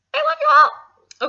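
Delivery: high, drawn-out shout; shouted sign-off. Real, not acted. Vlogger signing off to all the viewers, high loud shout. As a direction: holler it out to everyone, long and high.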